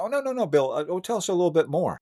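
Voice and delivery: high, eager prodding; eager group voice. Retold or imitated. Retold group pressing him eagerly.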